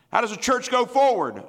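high, loud rhetorical call; loud preacher's challenge; preaching shout. Real, not acted. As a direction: fling the question at the church.

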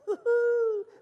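high, loud, drawn-out whoop; celebratory whoop. Real, not acted. Preacher celebrating the money for the truck, high whoop. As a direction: let out a joyful high hoot.

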